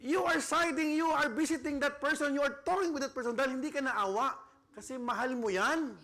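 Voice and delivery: loud, high preaching climax; full-force preaching. Acted. Preacher urging church to give out of love, loud. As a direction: preach it out with fire.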